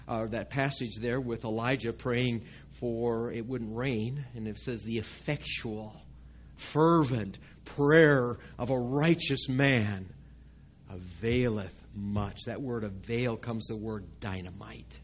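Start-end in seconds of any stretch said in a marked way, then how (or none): 4.8-12.5 scripture reading. Acted. Quoting scripture in sermon.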